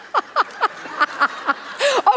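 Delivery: real high-pitched giggle at herself. Real, not acted. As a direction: burst into a squeaky laugh at your own look. Laughs at her own silly glasses.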